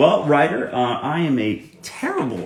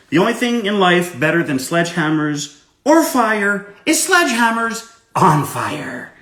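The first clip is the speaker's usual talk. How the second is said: louder, higher gleeful character voice; loud in-character recital of the written quote; Wrench voice; 9 semitones higher, 9 dB louder than usual. Acted. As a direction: deliver the quote as the manic hacker character. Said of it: "Can you write something in character to me".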